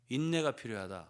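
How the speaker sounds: ordinary talk, nothing marked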